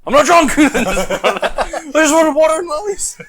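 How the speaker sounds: high, slow laughing shout; mock drunk yell. To the red-shirt volunteers, a laughing mock drunk shout. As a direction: belt it out like a drunk protesting.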